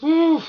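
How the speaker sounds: high loud sigh of relief; loud relieved whew. Real, not acted. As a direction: blow out relief. After the ascent.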